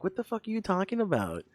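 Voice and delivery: high-pitched, incredulous retort; retold bafflement. Acts own baffled retort to friend.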